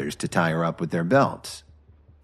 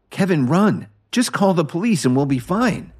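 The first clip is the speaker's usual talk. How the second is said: urgent, high-pitched mild shout; narrator's restrained shout, pitched up; 10 semitones higher, 3 dB louder than usual. A narrator's reading. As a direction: call out an urgent warning. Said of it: "she shouted as she struggled".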